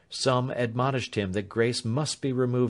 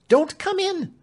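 much higher and louder, stress on come; frantic warning; 7 dB louder than usual. A narrator's reading. Clemency repeats her warning to Alfred, louder and frantic. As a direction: plead desperately, punching come. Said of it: "she answered".

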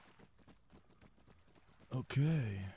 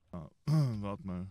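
usual speaking voice

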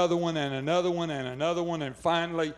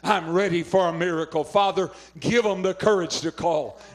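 preacher praying over the audience with music; fervent prayer; 4 dB louder than usual. Acted. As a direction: pray it out with fervour, a little louder.

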